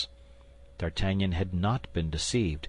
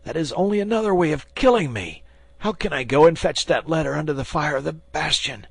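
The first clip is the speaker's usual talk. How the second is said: high, loud frightened outcry; panicked protest; 7 semitones higher, 4 dB louder than usual. A narrator's reading. Bandit protests being sent under fire, high frightened cry. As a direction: cry out in terror at the order. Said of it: "cried the bandit".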